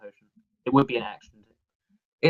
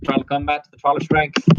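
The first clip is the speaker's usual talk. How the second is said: booming announcer, a notch higher; 5 semitones higher than usual. Acted. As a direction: proclaim it like an arena herald. GM voices helm announcer.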